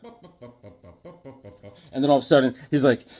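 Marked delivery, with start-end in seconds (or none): 0.0-1.8 very soft bops. Retold or imitated.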